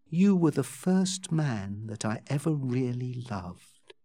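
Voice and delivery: soft, put-on bashful voice; intentionally shy. A narrator's reading. Becky confessing love to Joss, soft and deliberately shy. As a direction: say it softly, feigning shyness. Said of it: "in an intentionally shy voice".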